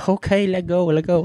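put-on eager Vietnamese-accented guide. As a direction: raise it up, keen tour guide with a Vietnamese accent. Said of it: "what happened to your accent?".

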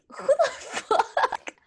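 high, slow, laughing "who the fuck"; high-pitched laughing outrage; laughing disbelief. Retold or imitated. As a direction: squeal it out through a laugh. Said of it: "And I was like".

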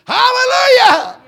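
high, loud, ringing hallelujah; preacher crying out in praise; shouted hallelujah. Real, not acted. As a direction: throw it out at full voice.

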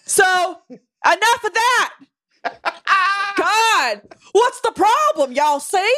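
high hammed-up Southern yokel accent, laughing; mock Southern yokel. Cohost mocking her friend's character in a hammed-up Southern accent. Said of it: "when I talk like this".

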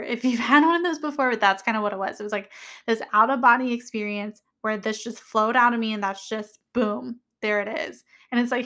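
ordinary talk

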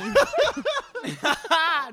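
squealing laugh, pitched high; helpless high laugh; high burst of laughter. Real, not acted. Teased about the video.

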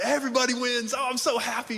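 high, gushing parody of delight; mock happy peacemaker. Preacher imitating people who want everyone winning, mock happy. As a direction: gush in a put-on high voice. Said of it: "maybe you're like that".

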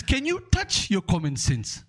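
put-on high, slow, cheeky child question; little Nigerian boy. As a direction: turn the professor's logic back on him. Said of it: "He says".

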